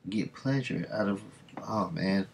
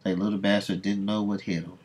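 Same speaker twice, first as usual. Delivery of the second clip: usual speaking voice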